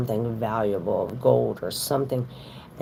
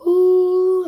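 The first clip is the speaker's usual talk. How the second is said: high, loud imitated party cry; celebratory high ooh; mock party whoop; 8 dB louder than usual. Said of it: "blowing your horn like".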